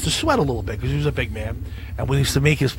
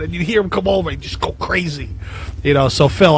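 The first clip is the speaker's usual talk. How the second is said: ordinary delivery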